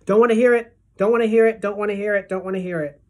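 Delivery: shouted then dying down; yelling, fading. Acted. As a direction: start yelling, then fade out. Jumps up covering ears, repeating.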